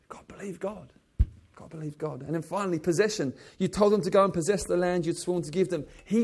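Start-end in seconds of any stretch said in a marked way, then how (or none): 0.1-0.9 a soft unvoiced whisper; whispered plea. Real, not acted. Preacher urging listeners to believe God, whispering. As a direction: breathe it to yourself like a prayer.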